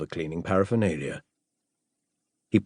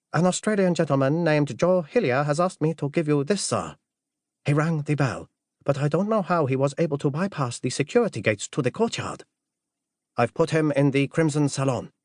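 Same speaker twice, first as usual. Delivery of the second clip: higher-pitched, dutiful butler voice; formal butler; 5 semitones higher than usual. Acted. Butler informing his employer of a visitor, high formal voice. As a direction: report deferentially, pitched up.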